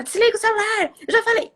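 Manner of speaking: put-on high, loud exasperated yell at the kid; nagging shout. Mother yelling at her son over his phone, loud and exasperated. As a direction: bark the order like a fed-up mum. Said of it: "a gente não precisa gritar".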